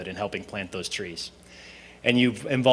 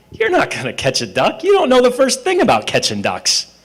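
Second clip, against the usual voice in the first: higher, louder mocking disbelief; scoffing friend; 12 semitones higher, 8 dB louder than usual. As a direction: scoff at his buddy's plan. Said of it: "he goes".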